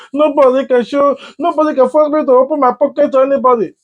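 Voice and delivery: high, fast, indignant mock protest; mock defiant refusal. Pretend thief refusing to open his pocket, indignant.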